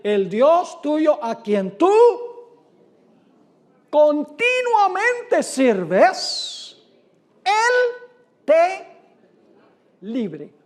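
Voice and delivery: slow, pleading king, voice rising high and loud; hammed-up anguished king pleading. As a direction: drag out the king's desperate cry, climbing. Preacher voices king's anguished plea.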